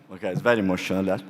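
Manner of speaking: ordinary talk, nothing marked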